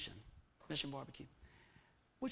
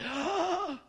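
high, loud stagey gasp of fright; sharp dramatic gasp; 10 semitones higher, 6 dB louder than usual. Acted. Preacher to congregation, acting out his fear, dramatic gasp. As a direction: suck in air as if struck with fear.

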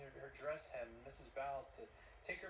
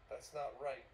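ordinary delivery

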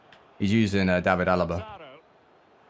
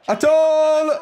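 high, loud cry at a goal; goal shout; 14 semitones higher, 6 dB louder than usual. Real, not acted. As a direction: yell the scorer's name in delight. Scoring a goal in FIFA.

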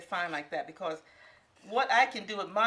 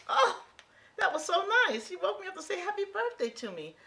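high delight; 11 semitones higher than usual. Real, not acted. Touched by grandbaby's birthday call.

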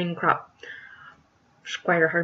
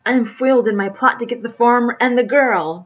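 loud, higher melodramatic villainy; ranting villain; 4 semitones higher, 9 dB louder than usual. Acted. Foiled squire vows revenge, ranting loudly. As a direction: declaim it like a thwarted schemer.

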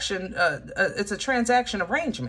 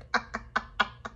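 breathless silent giggle she tries to suppress; stifled laugh; 3 semitones higher, 7 dB quieter than usual. Real, not acted. Reader trying to stop laughing mid reading. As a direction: choke back the giggle. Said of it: "Stop laughing".

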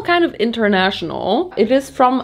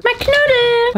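dismayed cry. Real, not acted. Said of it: "Why is it so small?".

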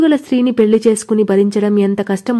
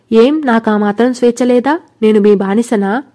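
usual speaking voice

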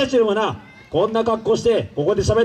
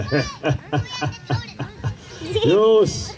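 villain chuckle; 4 semitones lower, much slower than usual. Acted.

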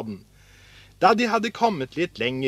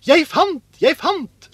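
high, loud cry of discovery; excited shout; 13 semitones higher, 7 dB louder than usual. A narrator's reading. Askeladden calls to his brothers about a find, shouting. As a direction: call out thrilled at a find. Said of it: "ropte han".

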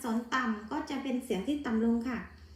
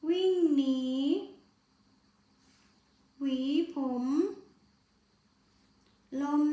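read-along word list. Shown as a demonstration. Teacher reading words one by one for the class to repeat. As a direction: give each word clearly for pupils to repeat. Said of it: "นักเรียนอ่านตามครูเลยนะคะ".

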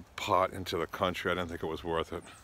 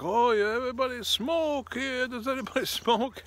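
bus driver offering pot. Retold or imitated. Driver selling weed to passengers, high mock voice. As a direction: pitch it like a cheerful hustler. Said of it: "he's like".

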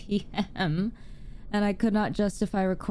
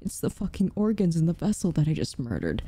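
normal talking voice

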